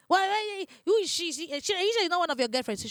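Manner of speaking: a mock suspicious wife grilling her husband; dramatic suspicion. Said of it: "you see them being dramatic".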